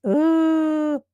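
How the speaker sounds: usual speaking voice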